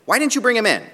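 high, loud demand read aloud. A narrator's reading. Priests and Pharisees question the returning temple guards, demanding. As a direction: demand it like an indignant authority.

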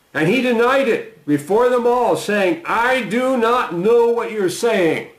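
high, slow and insistent denial in the reading; scripture reading. Acted. Reading Peter's first denial.

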